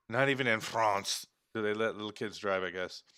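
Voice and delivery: ordinary delivery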